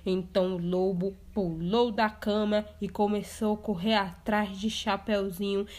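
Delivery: usual speaking voice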